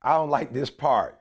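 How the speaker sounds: ordinary talk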